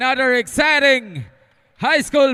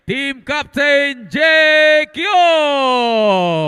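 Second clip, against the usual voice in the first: slow, stretched, loud player call; drawn-out name call; 8 semitones higher, 12 dB louder, much slower than usual. Acted. Announcer calling starter's name.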